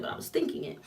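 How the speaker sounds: hushed confession; whispered. Real, not acted. Narrator whispering what she only thought. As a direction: drop to a whisper. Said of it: "I didn't say that".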